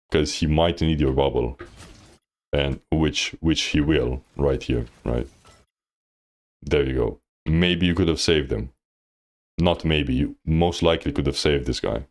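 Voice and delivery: normal talking voice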